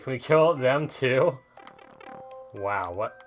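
ordinary talk

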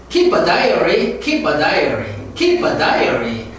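very slow keep a diary; 천천히 시범; slow drill. Shown as a demonstration. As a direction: model it slowly for repeating. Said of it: "따라해요".

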